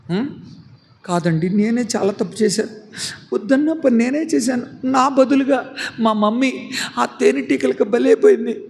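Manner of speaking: high, tearful insistence; remorseful child. Acted. Reena insisting to the doctor it's her fault, remorseful.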